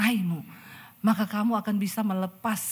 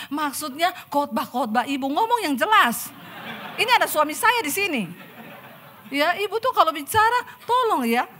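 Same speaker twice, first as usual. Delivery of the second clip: raised, higher, louder indignant protest; angry confrontation; 6 semitones higher, 4 dB louder than usual. Acted. Angry woman scolding the preacher in front of her husband, raised and high.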